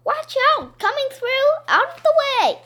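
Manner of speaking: high, loud warning shouts; yelling. A narrator's reading. Someone barrelling down a school hallway, yelling. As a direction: holler to clear the way. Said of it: "somebody yelling down the hallway".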